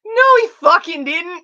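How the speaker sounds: loud, high, drawn-out outburst; indignant denial. Real, not acted. Answering a viewer saying Tim Curry peaked, outraged. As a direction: shout it down, stretching each word.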